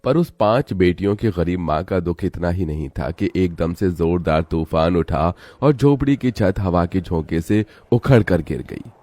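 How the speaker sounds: ordinary delivery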